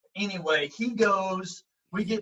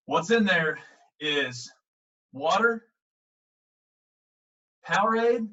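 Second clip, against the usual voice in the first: slow, loud, drawn-out reveal with pauses; dramatic reveal; 2 semitones higher, 6 dB louder than usual. Retold or imitated. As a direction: unveil it like a grand secret.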